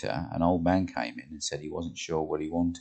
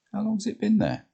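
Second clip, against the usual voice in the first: Jane's voice; 5 dB louder than usual. Acted. Jane worried about Carla.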